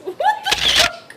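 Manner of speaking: high squeal, slow and loud; laughing disbelief. Real, not acted. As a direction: burst out in shocked laughter. Shock at misreading, laughing.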